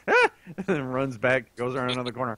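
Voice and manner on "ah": startled yelp. Pretend. Imitating startled monster.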